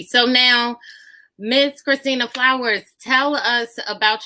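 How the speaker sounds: loud, high host introduction; announcer intro. Real, not acted. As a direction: introduce the guest with energy. Host introduces guest loudly.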